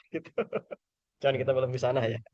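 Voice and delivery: ordinary talk, nothing marked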